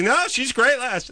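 high mocking impression of the neighbor. Neighbor mocked in a high voice. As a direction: mimic her in a squeaky pitch. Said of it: "she's like".

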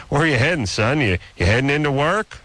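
a hint of a clueless father asking; imitating dad. Voicing his dad in a story.

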